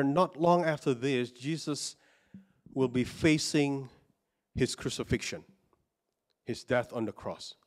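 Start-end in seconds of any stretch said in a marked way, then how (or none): none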